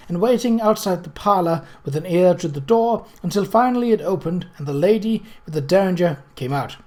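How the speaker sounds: usual speaking voice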